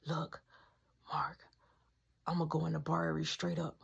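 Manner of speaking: whisper. Retold or imitated. Whispered plan.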